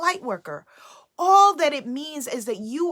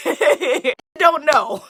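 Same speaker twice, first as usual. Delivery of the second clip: laughing; 6 dB louder, slower than usual. Real, not acted. Laughing at losing track.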